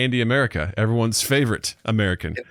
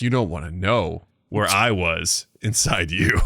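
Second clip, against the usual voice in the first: low, slow mock-ominous voice; creepy ghost reply; 6 semitones lower, slower than usual. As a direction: draw it out low, teasingly ominous. Voices ghost answering Whoopi Goldberg.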